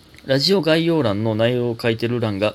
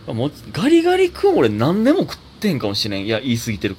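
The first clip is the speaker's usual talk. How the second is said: usual speaking voice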